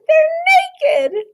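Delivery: very high squeal with a giggle; a little girl giggling out the joke. A narrator's reading. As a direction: squeal it with glee.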